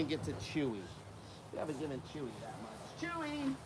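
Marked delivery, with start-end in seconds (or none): 3.1-3.5 high call to the dog; sing-song call to a dog; calling out the name. Real, not acted. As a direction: call him over.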